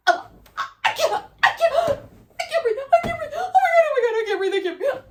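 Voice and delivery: very high, loud choked gasps; gasping panic. Retold or imitated. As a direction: gasp for air in terror. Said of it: "I'm like".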